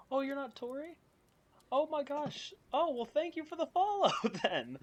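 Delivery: high, loud, delighted squeal of surprise; thrilled to spot a new follower; excited surprise. Real, not acted. Streamer thanking a surprise new follower, squealing with delight.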